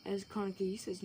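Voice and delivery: ordinary delivery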